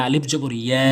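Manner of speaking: normal talking voice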